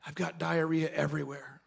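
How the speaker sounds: low, soft, miserable confession; imitated sick employee. Employee on the phone to the boss, mock miserable.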